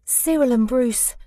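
plain reading voice

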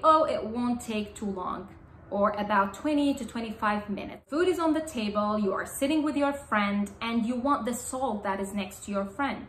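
ordinary talk

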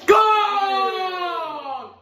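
high, loud screamed goal. Real, not acted. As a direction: scream it with pure joy.

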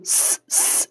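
whispered س س, thin and sharp; just hiss the س; unvoiced س hiss. Shown as a demonstration. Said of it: "یہ سین کی آواز ہے".

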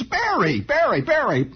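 high, singsong mock waitress; mimicking the waitress. Pie counter woman repeating herself, high singsong. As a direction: parrot it back high and flat-out insistent. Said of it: "She says".

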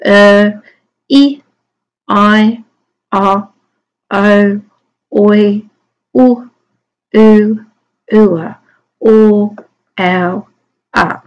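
isolated vowel sounds, a pause after each; each vowel a separate, clearly held phoneme; sounding out vowels. Shown as a demonstration. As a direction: chant through "Er" to "a" one sound at a time. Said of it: "Twenty vowels".

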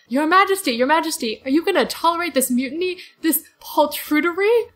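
loud, high, outraged little mouse; indignant Reepicheep. Retold or imitated. Reepicheep scolds the fleeing crew, loud and outraged. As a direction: demand it like an affronted knight. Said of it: "He's like".

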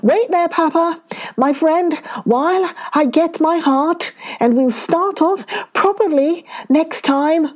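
high-pitched call to a friend. A narrator's reading. Monkey calls from the tree to waiting shark, calling out. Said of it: "calling out".

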